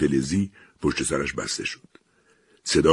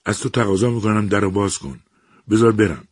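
higher, louder desperate begging; helpless plea; 6 semitones higher, 4 dB louder than usual. Acted. Eliza begs the machine to open the door, desperate and raised. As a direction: plead helplessly to be let out. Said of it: "با درماندگی خواهش کرد".

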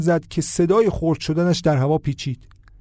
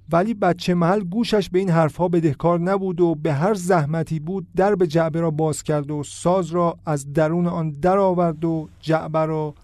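ordinary delivery

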